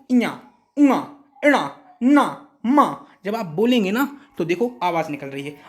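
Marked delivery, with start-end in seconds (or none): 0.1-3.1 इया उआ इणा ना मा sung through the nose; nasal demo. Shown as a demonstration. Teacher shows the nasal letters, humming them through the nose. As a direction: let each sound ring in the nose. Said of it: "बोल के देखो".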